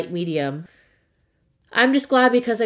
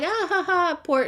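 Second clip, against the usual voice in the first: mock laugh; 11 semitones higher than usual. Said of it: "Not like".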